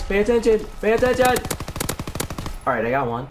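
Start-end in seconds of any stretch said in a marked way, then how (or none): 0.0-1.2 high urgency, rising on the repeat; urgent urging. Real, not acted. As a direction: urge sharply, climbing in pitch. Urging during a tense firefight.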